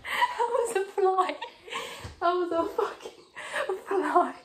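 high, slow, laughing squeal; laughing after fright. Real, not acted. Realising it was only a fly, laughing after fright. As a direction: squeal it through shaky laughter.

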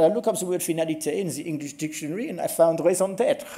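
put-on heavy French accent, breaking into laughter; mock French speaker, laughing through the line. Retelling the French lecturer's dictionary joke in a French accent. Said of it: "it's better in the French accent".

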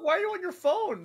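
high-pitched, loud disbelief; incredulous outburst. Real, not acted. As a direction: protest it in utter bafflement. Baffled by the ghost using phone.

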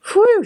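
high, loud whistling breath of relief; relieved whew. A narrator's reading. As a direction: let the tension go with a big whew. Teacher relieved after storm.

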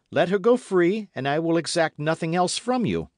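high, loud accusation and demand; impassioned young officer. A narrator's reading. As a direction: confront your lord with rising fervour.

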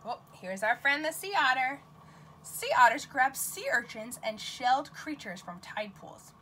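ordinary talk, nothing marked

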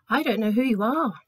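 a lightly changed voice, a touch stern; unseen voice refusing. Acted. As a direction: refuse entry from behind the gates. Voice behind gates refuses.

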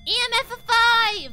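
loud, high excited call; excited shout. Real, not acted. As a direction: shout out the find. Excited game reading.

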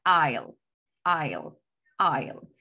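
"I'll" as one falling syllable; short form. Shown as a demonstration. Teacher shows the short form, "I'll" in one falling syllable. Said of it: "este se pronuncia".

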